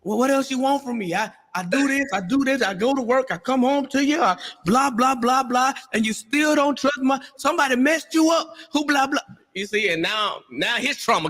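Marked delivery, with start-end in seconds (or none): 0.0-9.2 a husband finally snapping after too many asks; fed-up husband. Retold or imitated. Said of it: "when he finally gets that tone and he ra-, raises".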